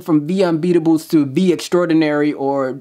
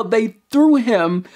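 ordinary talk

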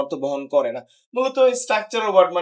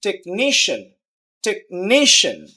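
"Technician" with stress on নি; stress before ending. Shown as a demonstration. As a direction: punch নি in "technician", drop the ending. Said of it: "শেষের আগেরটা দিতে হবে". ("টেকনিশিয়ান"-এর নি-তে জোর)

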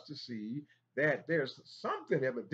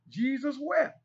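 exclaimed verse; 11 semitones higher, 7 dB louder than usual. Acted. Preacher exclaims key verse.

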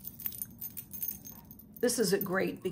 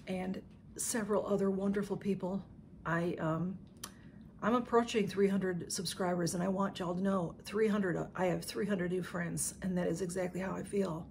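ordinary talk, nothing marked

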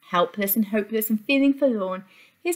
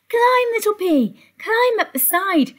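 high, loud urgent urging; 10 semitones higher, 4 dB louder than usual. A narrator's reading. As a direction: hurry the pea along. Urgent call to pea.

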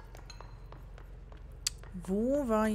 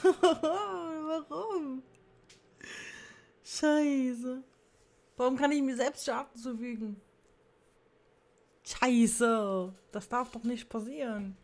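frustrated gamer; 6 semitones higher, slower than usual. Real, not acted. Gamer frustrated after dying.

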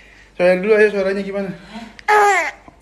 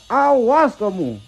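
Awas kamu stretched slow and higher, teasing; slow, higher playful menace on Awas kamu; drawn-out mock threat; 8 semitones higher, much slower than usual. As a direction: drag out Awas kamu like a cartoon villain.

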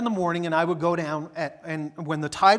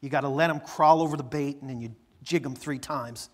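usual speaking voice

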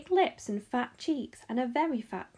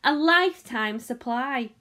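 slow, loud, drawn-out envy; loud, begrudging emphasis; envious; 8 dB louder than usual. A narrator's reading. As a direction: stretch it out enviously. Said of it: "another boy said enviously".

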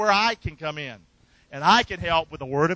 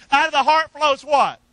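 louder, pushing the congregation; preacher pushing a sleepy crowd, louder and rousing; louder call to the crowd; 7 semitones higher, 7 dB louder than usual. Shown as a demonstration. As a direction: rouse the sleepy room. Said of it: "Can we say that a little louder?".

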